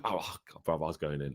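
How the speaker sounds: normal talking voice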